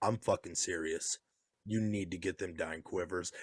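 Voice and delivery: dead serious dad. Retold or imitated. Dad stares down his doubting son, low quiet deadpan. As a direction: drop low and quiet like a father not playing. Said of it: "looked at me and he's like".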